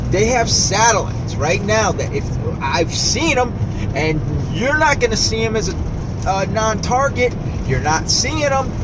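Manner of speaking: agitated rising rant; agitated insistence. Real, not acted. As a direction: insist urgently nobody else sees them. Insisting he has seen satellites.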